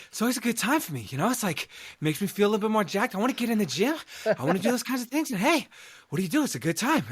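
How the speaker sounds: high-pitched, eager celebrity impression; Mark Wahlberg. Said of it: "doing a Mark Wahlberg impression".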